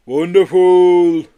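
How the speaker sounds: slow, far-off creature voice; Yeti voice. A narrator's reading. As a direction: drawl it out like a big gentle Yeti.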